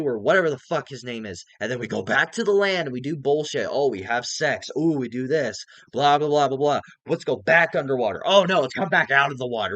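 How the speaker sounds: shouting, worked up and mocking the plot; exasperated loud rant. Real, not acted. Vlogger ranting at viewers about the movie, loud mocking exasperation.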